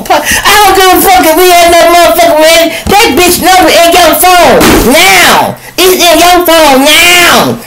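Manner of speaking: high, loud furious woman; angry girlfriend. Acted. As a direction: screech at him for cheating. Acting out angry woman in argument.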